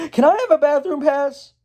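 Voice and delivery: high, urgent schoolkid request; hammed-up high-pitched asking; students asking permission. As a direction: plead it high, like students in class. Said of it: "And they're all like".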